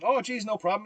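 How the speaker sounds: mock French accent, pitched well up; lightly hammed French accent. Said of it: "in his French accent".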